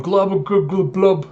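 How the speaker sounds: high bubbly drowning noises; imitated glubbing. Man dragged underwater on a chain, mock glubbing. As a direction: bob the voice like someone gulping water.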